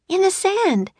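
put-on high, urgent child's voice; urgent reply. Annie tells Jack where to hide it, urgent. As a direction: answer high-pitched and pressing.

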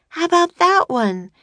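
high, bright little sister calling Jack; girl's voice. A narrator's reading. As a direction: pitch up high for the young girl.

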